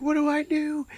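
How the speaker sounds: high helpless question; high-pitched panicky stoner; mock panic. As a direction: squeak it out in comic distress. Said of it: "he's like".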